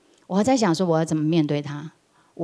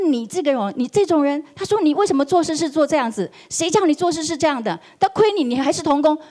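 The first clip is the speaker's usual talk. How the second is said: higher, heated mock tirade; retold angry scolding; 7 semitones higher than usual. Boss berating employee, pointing at his nose, heated scold. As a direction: reenact the boss berating him.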